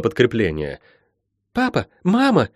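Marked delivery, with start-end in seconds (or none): 1.5-2.5 high, short, anxious calls; high-pitched searching cries; calling out. Acted. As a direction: cry out for parents in a high voice. Girl calls for parents.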